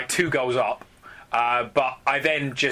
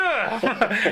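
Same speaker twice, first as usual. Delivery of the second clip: laughing; 9 semitones higher than usual. Real, not acted. Laughing at own French voice.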